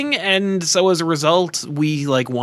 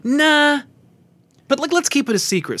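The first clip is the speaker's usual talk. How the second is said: dismissive studio exec; 11 semitones higher than usual. Retold or imitated. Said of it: "the movie studio's like".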